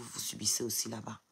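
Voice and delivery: whispered aside; bitter whispered aside. Real, not acted. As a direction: drop to a whisper. Aside about commenters' own misery.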